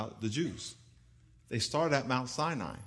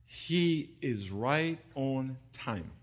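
normal talking voice

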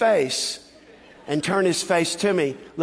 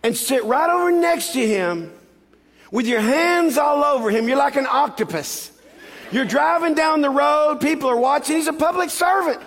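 mother giving the business; 7 semitones higher than usual. Retold or imitated. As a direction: imitate an outraged mom laying down the law. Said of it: "gave me the business".

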